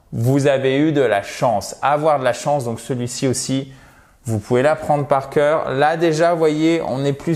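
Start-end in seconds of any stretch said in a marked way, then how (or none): none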